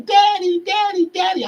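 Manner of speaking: put-on high, loud childlike wail of daddy; imitating crying child. Son calling for his beaten father, mock high child's wail. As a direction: mimic a small boy crying for his father. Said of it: "His son is crying".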